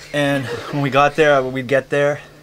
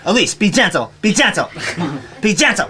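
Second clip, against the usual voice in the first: insistent mother, louder and higher, escalating; caring mother, mock; 4 semitones higher, 4 dB louder than usual. A mock caring mother to her tiny child, louder and louder. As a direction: start firm and build toward yelling. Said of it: "a caring mother, talking to her tiny".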